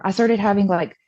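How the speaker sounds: ordinary talk, nothing marked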